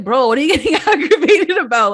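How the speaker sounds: high, loud mock disbelief; imitated scolding, cracking up; incredulous laughing retort. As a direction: laugh through an exasperated comeback. Mocking retort acted out, laughing.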